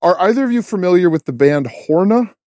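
ordinary talk, nothing marked

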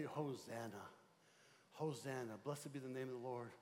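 quiet, prayerful Hosanna; soft Hosanna. Shown as a demonstration. Pastor showing the church a quiet prayer, hushed. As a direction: breathe it out like a private prayer. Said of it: "you just say".

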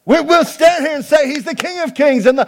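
loud, high, driving sermon voice; preaching at full force. Real, not acted. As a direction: preach it out to the room.

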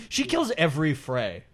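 ordinary delivery

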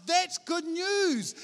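high, drawn-out exclamation; emphatic delight. Acted. Preacher exclaiming over a friend's new freedom, emphatic delight.

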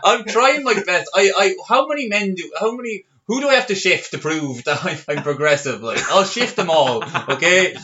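hammed-up desperate pleading; mock desperate.

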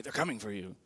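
low, soft, mock-ominous warning; acted low warning to stoke fear; hushed scare message. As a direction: creep in quietly like a scary meme. Imitating fear-stoking social media memes.

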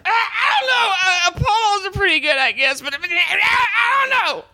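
very high, strained, screechy comic voice; Bobcat Goldthwait impression. Doing Bobcat Goldthwait for a friend, high strained screech. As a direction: squeal it out like Bobcat's stage character. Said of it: "That's how he sounds".

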